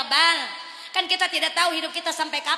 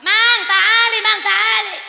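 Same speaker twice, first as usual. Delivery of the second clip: loud, high shout of "Mang, tali"; calling the becak driver; 7 semitones higher, 10 dB louder, much slower than usual. Retold or imitated. Returned migrant worker hailing a becak driver with a loud shout. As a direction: hail the driver from across the street. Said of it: "ngelihat tukang beca".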